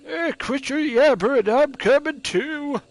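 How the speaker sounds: put-on grumpy old man. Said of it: "I gotta prepare my old man voice".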